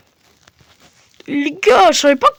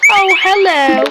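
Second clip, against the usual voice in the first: loud, bright hammed-up English hello; crazy English accent; 5 semitones higher, 6 dB louder, much slower than usual. Player greeting the new neighbour in a mock English accent. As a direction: greet him in an over-the-top English accent. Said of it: "l'accent anglais de fou que j'ai".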